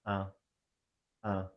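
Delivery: "un" with the mouth slightly open, nasal. Shown as a demonstration. Teacher demonstrating nasal "un" to class. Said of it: "your nose should vibrate a bit".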